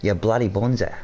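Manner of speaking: jokey put-on Australian accent; playful imitation of Australian slang talk; mock Australian accent. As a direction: do a hammed-up Aussie accent. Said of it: "stop with these accents and stuff".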